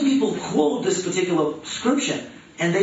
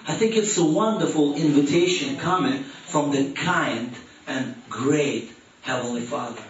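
ordinary delivery

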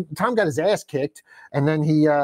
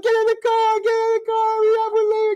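much higher, panicky mock rush; frantic cowardly boyfriend. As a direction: hurry everyone out in a hammed-up high panic. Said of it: "And he's like".

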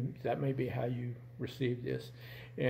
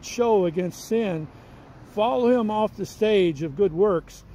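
put-on a measured, louder reading of an old text; reading aloud; 7 semitones higher, 10 dB louder than usual. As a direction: read the old book to the congregation. Reads old Puritan text aloud.

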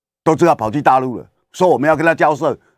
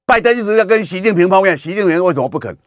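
usual speaking voice

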